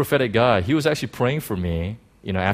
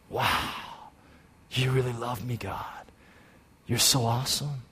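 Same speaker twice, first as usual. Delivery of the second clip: much softer, breathy-free whispered awe; awed whisper; 8 dB quieter than usual. Retold or imitated. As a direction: whisper your thanks to God. Intimate whispered praise to God.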